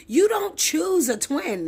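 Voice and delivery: high and loud, a bit slow; heated. Real, not acted. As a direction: hammer it home. Heated rant.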